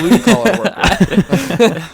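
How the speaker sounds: high, loud, slowed through laughter; laughing echo. Real, not acted. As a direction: crack up while repeating it. Echoes the remark while cracking up.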